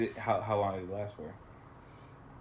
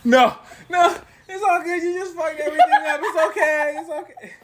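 high, loud laughing through words; shrieking laughter, mock consolation; laughing hard; 6 dB louder than usual. Real, not acted. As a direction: crack up while consoling. Cracks up at the story.

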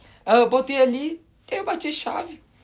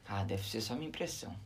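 quiet inner thought; 10 semitones lower, 18 dB quieter than usual. Retold or imitated. Retold thought, very low and soft.

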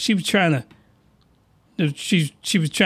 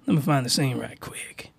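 whispered aside; hushed aside; 7 semitones lower, 8 dB quieter than usual. Real, not acted. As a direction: murmur it under your breath to the audience. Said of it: "whispered aside".

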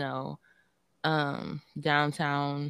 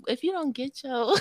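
higher voice breaking up into laughter; retold warning, laughing; 6 semitones higher than usual. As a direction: laugh through a mock warning.

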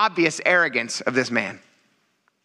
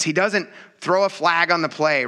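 ordinary talk, nothing marked

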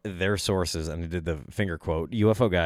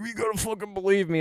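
much higher, whiny put-on plea; mock pleading insider; 12 semitones higher than usual. Mocking a UFO guy begging listeners to believe him. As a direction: beg in a squeaky mock voice.

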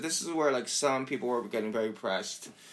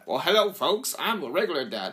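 louder, higher goofy dad greeting; dorky dad voice; 5 semitones higher, 3 dB louder than usual. Retold or imitated. Imitating Vanjie's dorky dad sketch, goofy and bright. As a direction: greet the folks like a corny dad. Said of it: "the dorky one".

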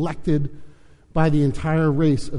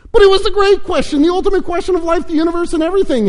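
high, loud, fast wail; howling protest; 6 dB louder, faster than usual. A narrator's reading. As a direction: howl in exasperation. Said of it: "howled Lun Kuo".

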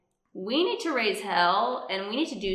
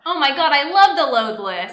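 excited; 5 semitones higher, 10 dB louder than usual. Pretend. Excited reaction in skit.

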